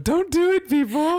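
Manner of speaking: high, loud plea to the audience; warning call. Real, not acted. Host calls out to warn listeners, high and loud. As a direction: cry out to stop them.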